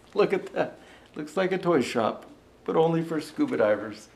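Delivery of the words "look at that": ordinary delivery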